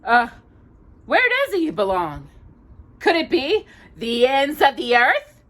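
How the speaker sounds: high, loud squawky taunt; mock him like a cheeky parrot. Iago taunts Aladdin, high mocking parrot. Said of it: "Iago taunted".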